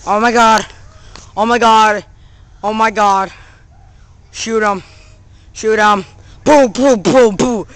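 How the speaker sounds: loud frantic yelling at the game; panicking gamer. Real, not acted. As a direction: panic as the enemies close in. Enemies attacking in game.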